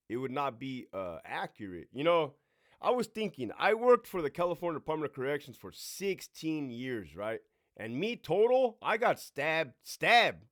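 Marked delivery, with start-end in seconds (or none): none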